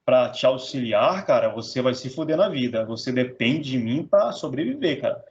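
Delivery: low-pitched parody of a scaremongering salesman; put-on low voice warning of hardship; mock course seller. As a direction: drop the pitch and sell the difficulty. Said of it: "te apresento a dificuldade".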